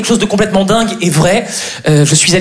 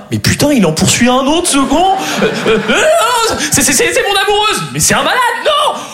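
high, stammering chase cries; mock panic; 8 semitones higher than usual. Man chased by agents after his girlfriend, mock panicked shrieking. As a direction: escalate into high-pitched hammed-up alarm.

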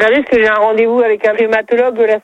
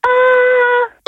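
much higher ah on request. Real, not acted. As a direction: push the ah up high. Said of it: "maintenant un A aigu".

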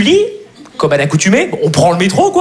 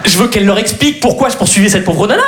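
ordinary talk, nothing marked

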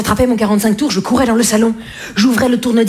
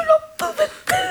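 high squeak; 4 dB quieter than usual. Retold or imitated. Child's exasperation at classical music.

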